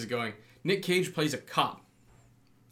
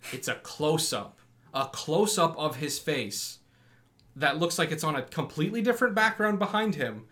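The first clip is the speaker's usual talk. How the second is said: usual speaking voice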